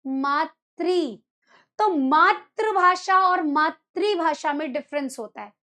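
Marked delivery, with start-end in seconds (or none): 0.1-1.2 मातृ drawn out very slowly, each sound clear; correct मातृ. Shown as a demonstration. Teacher slowly models the right मातृ for students. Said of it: "हिंदी में क्या बोलेंगे उसे".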